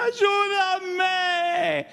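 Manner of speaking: high shouted plea for help; high desperate shout, ¡Ayúdame!; cry for help. Acted. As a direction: scream up from the bottom of the pit. Crying for help from the pit.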